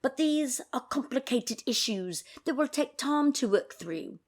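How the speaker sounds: mock posh British accent; jokey British accent for the palace statement. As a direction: read the statement like a stiff British royal aide. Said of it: "I should do this in a British accent".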